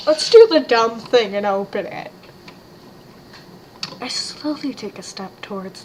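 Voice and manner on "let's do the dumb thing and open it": loud character voice. Acted.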